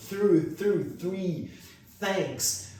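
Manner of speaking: tongue-tip TH in "through", "three", "thanks"; TH sound. Shown as a demonstration. Teacher showing how TH should feel, careful TH sound. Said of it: "som de T H do jeito certo".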